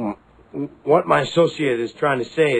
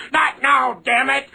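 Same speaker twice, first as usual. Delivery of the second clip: irritated dad; 8 semitones higher, slower than usual. Acted. Dad snapping at kids.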